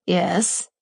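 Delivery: hesitant, unsure yes; tentative. Acted. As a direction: say it warily. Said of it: "he says tentatively".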